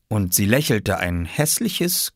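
ordinary delivery

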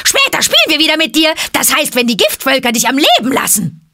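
high, loud and fast mockery; cackling apes taunting the boy; shrill monkey jeer. A narrator's reading. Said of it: "brüllten die Affen".

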